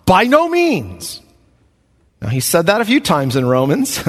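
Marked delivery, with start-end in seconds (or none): 0.0-1.2 slow, weighty "By no means." with "no" leaned on hard; emphatic denial. Acted. Preacher voicing Paul's answer to the congregation, slow and emphatic. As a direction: plant each word of "By no means." slowly, hitting "no". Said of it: "there's an emphatic response".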